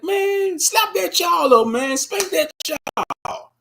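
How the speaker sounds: put-on high, pushy call to spank the child, slowing to a drag at the end; egging the mother on from the aisle; urging bystander. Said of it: "you be like".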